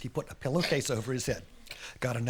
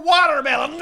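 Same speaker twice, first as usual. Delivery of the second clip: plain reading voice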